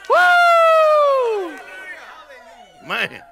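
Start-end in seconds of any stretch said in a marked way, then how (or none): 0.1-1.6 high, loud whoop of joy; joyful whoop. Real, not acted. Preacher whooping at the congregation, loud and high. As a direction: let out a ringing woo. Exuberant shout after praise.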